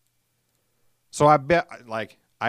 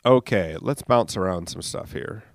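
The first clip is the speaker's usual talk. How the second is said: normal talking voice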